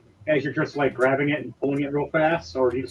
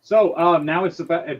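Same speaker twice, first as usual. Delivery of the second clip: usual speaking voice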